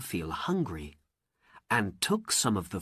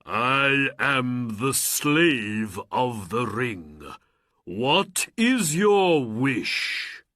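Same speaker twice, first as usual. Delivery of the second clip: slow, loud, grand genie voice; booming genie; 4 dB louder, much slower than usual. Acted. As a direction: announce yourself like a mighty spirit. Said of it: "the genie cried".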